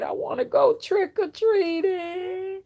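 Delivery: high, drawn-out whiny plea; childish wail. Retold or imitated. Child longing to go trick-or-treating, mock wailing. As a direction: mimic a kid wailing to be let out. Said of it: "I wanted to say so bad".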